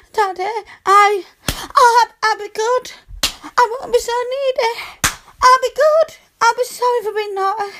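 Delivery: high, loud yelping pleas; pleading cries. Acted. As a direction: cry out and beg between yelps. Cries during spanking.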